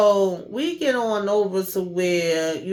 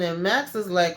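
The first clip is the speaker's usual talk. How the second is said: ordinary delivery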